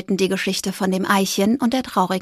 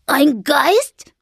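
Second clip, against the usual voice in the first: a touch of startled alarm, louder; 5 semitones higher, 5 dB louder than usual. A narrator's reading. Fridolin hears of the ghost, mildly startled question. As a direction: gasp out the word like a frightened squirrel. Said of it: "riss entsetzt die Augen auf".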